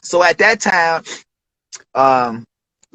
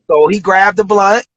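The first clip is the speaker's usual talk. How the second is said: ordinary delivery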